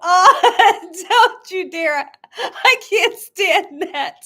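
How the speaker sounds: loud, slow, laughing don't-you-dare; laughing protest. Real, not acted. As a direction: protest through giggles. Mock protest to viewer.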